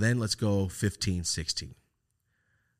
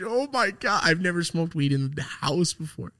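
thrilled squeal; 9 semitones higher, 3 dB louder than usual. Retold or imitated. Teen thrilled about smoking inside.

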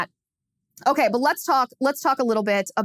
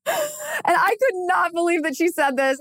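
talking through real laughter, pitched up; laughing; 8 semitones higher than usual. Real, not acted. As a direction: still cracking up while telling it. Laughing while recounting the remark.

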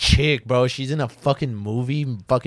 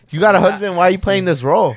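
mock outraged; 6 semitones higher, 5 dB louder than usual. Mock outraged question to actress.

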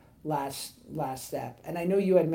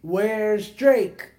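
louder, drawn-out mock fan yell; retold screaming; 4 semitones higher, 8 dB louder, much slower than usual. Fan at McDonald's yelling for a line, mock loud slow yell. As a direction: imitate the fan yelling across the counter. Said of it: "she just starts screaming out".

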